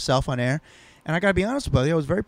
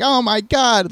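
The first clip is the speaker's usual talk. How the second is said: higher-pitched pretend shock; mock outrage; 7 semitones higher, 4 dB louder, slower than usual. Cohost showing how offended he isn't, in mock outrage. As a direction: play at being scandalized. Mimics an over-offended reaction.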